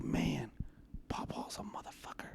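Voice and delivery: hushed whispered aside. Retold or imitated. Telling the story of grandpa's riddle, whispered aside. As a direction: whisper it like a secret punchline.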